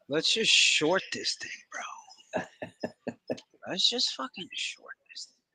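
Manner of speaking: unvoiced whisper to the crew; whispered urging. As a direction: whisper it like a secret plan. Conspiratorial aside about shorting.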